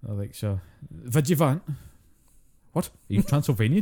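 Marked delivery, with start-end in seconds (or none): 0.3-1.6 imitated Highland Scot, W turned to V; slow, higher take-off of Highland speech; Highland accent. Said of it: "talking to someone from up in, up in the Highlands". 2.7-3.8 higher, incredulous question; mock bafflement. Mock baffled reply to Highlander.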